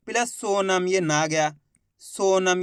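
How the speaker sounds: ordinary talk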